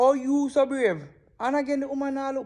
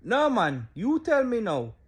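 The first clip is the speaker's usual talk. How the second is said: ordinary delivery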